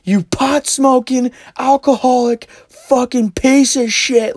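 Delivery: high, loud, slow string of insults; each insult drawn out in a mock shriek; imitated screaming. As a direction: mimic an enraged woman screaming abuse. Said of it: "like, intense arguments".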